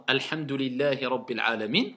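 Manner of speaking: Alhamdulillahi with an open, front first vowel; récitation correcte. Shown as a demonstration. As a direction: model Alhamdulillahi, first A between a and é. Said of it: "Si vous récitez, par exemple". (récitation correcte d'Alhamdulillahi, A léger)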